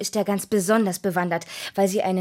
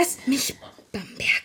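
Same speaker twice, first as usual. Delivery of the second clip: whispered; 3 semitones higher than usual. Acted. Rike pushes a suitor away.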